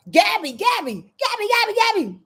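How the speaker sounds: high, sing-song "Gabby" called over and over; excited calling. Real, not acted. Host greeting a viewer in chat, high playful calling.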